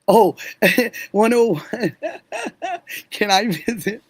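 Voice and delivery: words through giggles; laughing. Real, not acted. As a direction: laugh as you say it. Laughing over a viewer's comment.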